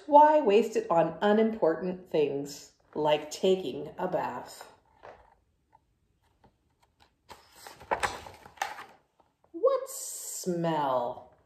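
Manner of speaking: loud, brash pigeon voice, brushing it off; the pigeon, loud and sure of itself, to the kids; cocky pigeon. Acted. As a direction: play the stubborn pigeon who dodges the bath. Pigeon dismissing baths.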